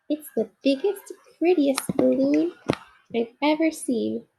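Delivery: a toy bear gazing up in awe; awed little bear. Acted. Corduroy sees the balloon.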